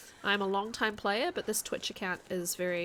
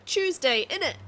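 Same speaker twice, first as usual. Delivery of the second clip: high, loud British "Tuesday, innit?"; quick hammed-up British quip; British accent; 9 semitones higher, 10 dB louder than usual. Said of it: "we say Tuesday".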